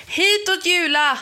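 high-pitched urgent shout. A narrator's reading. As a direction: call out loudly to a friend. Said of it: "skrek Emanuel".

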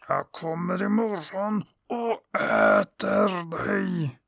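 slow hungry sun monster demanding its prey; menacing sun monster. Acted. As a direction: let the hungry sun growl out a threat, slowly. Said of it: "sa han".

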